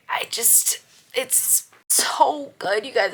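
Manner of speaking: slow, breathless whisper of awe; slow awestruck whisper; gushing whisper. Acted. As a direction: whisper it slowly like a secret. Gushing about the series.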